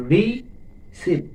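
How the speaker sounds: teacher separating রি from সিভ; syllable by syllable. Shown as a demonstration. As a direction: break receive into two slow beats. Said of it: "এইভাবে আমরা সহজে syllable".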